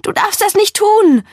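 high, loud, pleading cry; calling out. A narrator's reading. Begging Joey not to drown herself, calling out. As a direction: shout it as an urgent plea. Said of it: "rufe ich".

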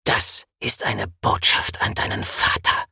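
hissing ninja whisper, low and menacing; hissed threat. A narrator's reading. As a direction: hiss it through the mask like a killer. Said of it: "zischte der Ninja".

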